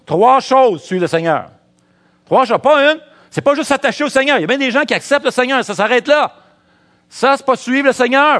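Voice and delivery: high, loud preacher's cry; rising sermon fervour; full-force preaching. Acted. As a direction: preach it hard to the congregation.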